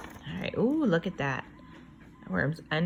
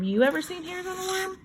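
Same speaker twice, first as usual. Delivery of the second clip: excited question; 12 semitones higher, faster than usual. Acted. Asks children excitedly.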